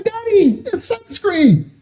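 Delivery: put-on high, slow little-kid voice; little son. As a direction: play the small boy, innocent. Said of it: "he's like".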